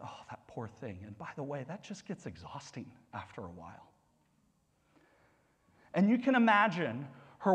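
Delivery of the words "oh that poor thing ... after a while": much softer, lower pitying gossip voice; mock pitying murmur. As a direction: murmur it like a tut-tutting neighbour.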